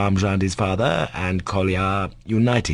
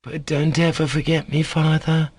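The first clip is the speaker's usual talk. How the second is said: put-on sick boy, pleading; 8 semitones higher than usual. A narrator's reading. Dying boy asks his father not to cry, pleading. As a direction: plead tenderly through sorrow.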